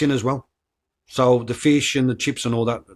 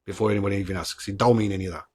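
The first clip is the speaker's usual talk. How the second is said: ordinary delivery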